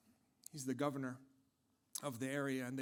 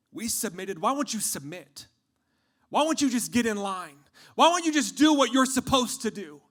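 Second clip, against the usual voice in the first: resentment building from raised voice to high shout; louder and higher with each question; angry demand; 6 semitones higher, 15 dB louder than usual. Acted.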